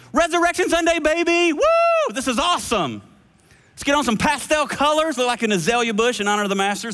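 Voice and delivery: high, hyped-up party voice; mock Easter hype. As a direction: ham up a giddy churchgoer. Mocking celebratory Easter crowd.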